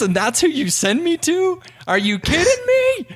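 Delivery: high, outraged disbelief; can't believe what mom did; incredulous. Retold or imitated. Mom sent him to bad dentist.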